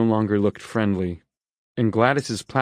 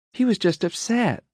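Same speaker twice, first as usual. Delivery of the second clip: kind; 12 semitones higher than usual. A narrator's reading. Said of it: "genuine kindness in her voice".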